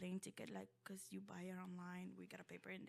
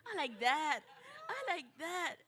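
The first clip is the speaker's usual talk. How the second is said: put-on high, loud kid-in-a-toy-store delight; excited toy craving; 7 semitones higher, 12 dB louder than usual. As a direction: exclaim it eagerly, pitch leaping up. Child wanting toys, acted out.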